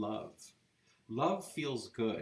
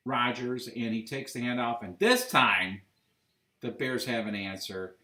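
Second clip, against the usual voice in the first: play-by-play; 2 semitones higher, 7 dB louder than usual. Acted. Live game play-by-play.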